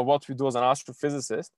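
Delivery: ordinary talk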